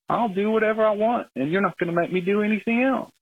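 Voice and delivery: high-pitched voice for an unbothered bear; defiant unstartled bear. Retold or imitated. Caller voices the bear answering his yelling, high mock defiance. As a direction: pitch it well up, playing the bear refusing to budge.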